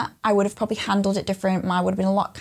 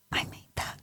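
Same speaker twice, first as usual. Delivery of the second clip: hushed, whispered wonder; awed whisper; 13 dB quieter than usual. Retold or imitated. Mum gazing at sleeping child, awed whisper. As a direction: whisper it as if not to wake them.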